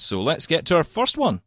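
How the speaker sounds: ordinary talk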